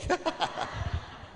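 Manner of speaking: light breathy chuckle, high; breathy high laugh. Real, not acted. Preacher laughing at his garrote joke, airy.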